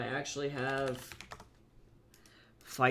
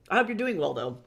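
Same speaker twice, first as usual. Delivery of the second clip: usual speaking voice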